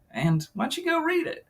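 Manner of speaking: usual speaking voice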